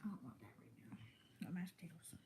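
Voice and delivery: whisper. Real, not acted. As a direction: whisper it to yourself, refusing food. Turning down food, full.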